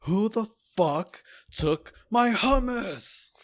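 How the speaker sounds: slow, high, mock-furious yell; yelling across the room. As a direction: bellow it at the whole house. Roommate yelling over stolen food.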